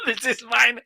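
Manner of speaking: high, loud, through laughter; laughing. Real, not acted. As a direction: say it while still laughing. Joking that the mic is theirs.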